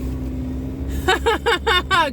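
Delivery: amused cackle; laughing. Real, not acted. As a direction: burst of laughter. Driver laughs at her own joke.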